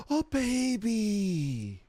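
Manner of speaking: ordinary talk, nothing marked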